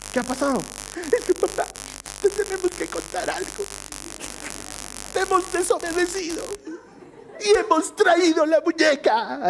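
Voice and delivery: small daughters owning up to their dad, timid; little girl voice. Retold or imitated. Daughters confessing, voiced by father.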